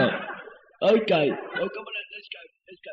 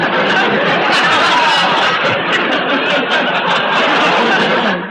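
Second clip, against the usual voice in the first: long, loud burst of laughter; loud laughing fit; 2 semitones higher, 9 dB louder than usual. Real, not acted. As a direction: crack up completely. Loud laugh after insult.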